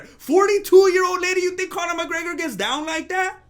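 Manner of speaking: high-pitched incredulous rant; indignant disbelief. Acted. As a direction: ask it high, as an outraged challenge. Mock outrage at her age.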